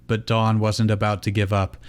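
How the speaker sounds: ordinary delivery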